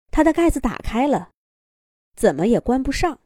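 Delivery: ordinary delivery, nothing marked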